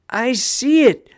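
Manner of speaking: loud, high-pitched thrilled exclamation. A narrator's reading. Daub sees the glowing dome too, thrilled. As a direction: burst out with delight at the sighting. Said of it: "said a thrilled Daub".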